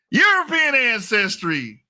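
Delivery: high, drawn-out announcement with amusement; mock dramatic reveal. Speaker revealing a DNA result to viewers, mock dramatic. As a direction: stretch it out as a teasing big reveal.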